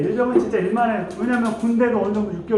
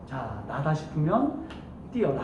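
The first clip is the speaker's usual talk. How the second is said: drawn-out, lower and softer order to the privates; hint at the wavering ones, slowly and quietly; a mild slow mock order; slow low corporal line; 6 semitones lower, 8 dB quieter, slower than usual. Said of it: "그런 애들을 겨냥해서 하는 거죠".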